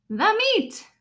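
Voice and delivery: hammed-up crew roar. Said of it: "we all roared".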